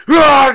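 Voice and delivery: very high, loud strangled roar; rage yell. Retold or imitated. Husband attacks his wife at the top of the stairs, screaming. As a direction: let out a furious scream as he attacks.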